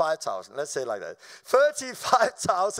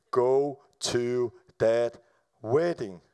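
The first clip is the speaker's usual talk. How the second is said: voice of God; 9 semitones lower, much slower than usual. Retold or imitated. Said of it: "it just came to me".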